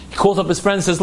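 plain reading voice

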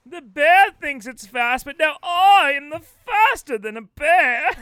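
loud, emphatic mock-actor boast; hammy actor. Actor boasting he can outrun bears, hammed-up. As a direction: declaim it with heavy theatrical punches. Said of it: "playing the role of the Bear Prince".

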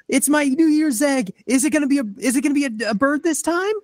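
put-on high, eager little kid; excited child voice. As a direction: squeal it like a curious child. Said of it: "a child, like".

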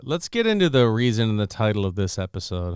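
usual speaking voice

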